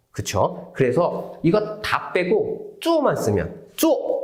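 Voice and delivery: ordinary talk, nothing marked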